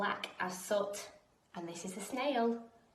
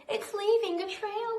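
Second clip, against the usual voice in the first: put-on excited children; 9 semitones higher, 3 dB louder than usual. A narrator's reading. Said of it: "say the children".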